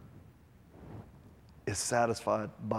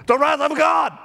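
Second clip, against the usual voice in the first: much higher, much louder booming cry; sudden loud shout; 11 dB louder than usual. Retold or imitated. Dying young preacher suddenly crying out, booming shout. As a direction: blast it out of nowhere. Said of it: "he goes like this".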